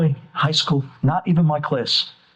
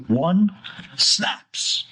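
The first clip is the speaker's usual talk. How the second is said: normal talking voice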